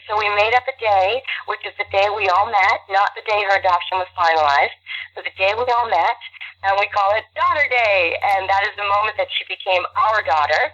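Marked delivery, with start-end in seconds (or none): none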